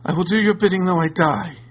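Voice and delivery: high, loud desperate vow. A narrator's reading. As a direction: swear it in desperation. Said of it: "replied the lady desperately".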